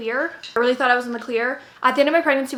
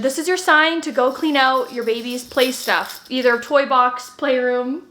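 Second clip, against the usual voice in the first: ordinary talk, nothing marked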